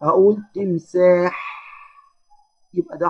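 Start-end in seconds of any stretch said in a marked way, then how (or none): 0.6-1.6 slow تمساح, ending on a held airy ح; stop on final ح. Shown as a demonstration. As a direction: say تمساح as "timsāḥḥ", resting on the last sound. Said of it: "وأقف على حرف الحاء".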